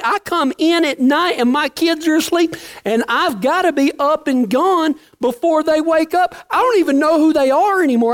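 mock frantic complaining. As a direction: play an overwhelmed ranch hand pleading. Overworked friend complains on phone.

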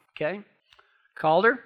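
ordinary delivery

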